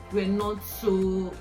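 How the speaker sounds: normal talking voice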